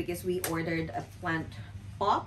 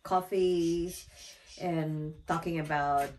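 ordinary delivery

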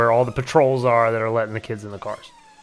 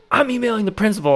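quoting wife; 8 semitones higher than usual. Retold or imitated. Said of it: "My wife was like".

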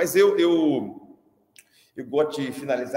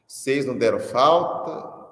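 ordinary talk, nothing marked

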